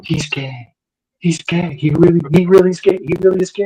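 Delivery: hushed, stunned repeating; soft disbelief, almost to herself; crushed mom. Retold or imitated. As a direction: mom quietly taking in bad news. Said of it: "She's like".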